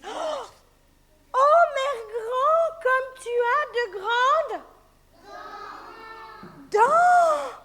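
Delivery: put-on frightened girl. Girl terrified at the wolf's teeth. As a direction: shriek at the teeth.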